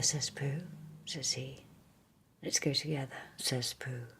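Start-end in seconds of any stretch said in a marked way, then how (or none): none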